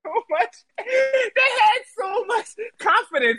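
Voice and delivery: high cackling through words; laughing. Real, not acted. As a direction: laugh while talking, slowing. Cackling about rival collapse.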